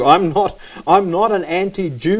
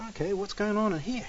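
hushed, uneasy question to himself; wary inner thought; 2 semitones higher, 13 dB quieter, faster than usual. Retold or imitated. Preacher recalls entering the dark smoky room, hushed wary thought. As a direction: drop to a very soft aside.

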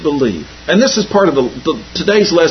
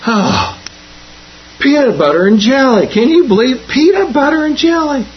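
higher and higher, mock-outraged schoolkid; exasperated kid; 10 semitones higher than usual. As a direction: build the kid's disbelief to a hammed-up peak. Kid complains third day, building.

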